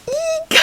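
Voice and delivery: tiny high eek of glee; a giddy little eek; excited squeak. Real, not acted. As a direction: squeak with delight.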